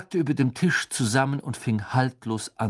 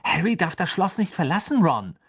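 put-on high, insistent girl's reproof; urgent objection; 8 semitones higher, 4 dB louder than usual. A narrator's reading. Hermine tells Ron Harry must stay, insistent. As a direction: object firmly, starting high.

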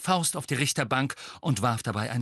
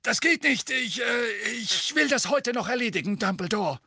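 flustered official; 13 semitones higher than usual. A narrator's reading. Flustered Fudge continues.

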